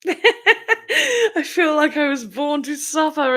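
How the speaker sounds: slow, laughing mock suffering; hammed-up tragic sigh, laughing; sad girl line. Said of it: "is my sad girl line".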